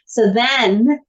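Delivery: normal talking voice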